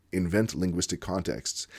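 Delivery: ordinary talk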